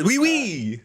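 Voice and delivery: high-pitched mock French accent; put-on French lilt. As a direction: echo it in a playful French voice. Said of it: "Hashtag French accent".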